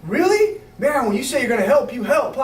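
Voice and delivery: high, amazed and impressed; a hammed-up wow from the friend; mock amazed Johnny. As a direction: sound floored by the help. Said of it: "I said".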